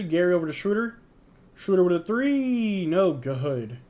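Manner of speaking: lively mock broadcaster call; amateur sportscaster; play-by-play. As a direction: call the action like a TV commentator. Calls the game play-by-play.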